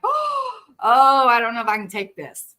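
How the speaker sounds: half-laughing mock overwhelm, too moved to cope. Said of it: "I was just like".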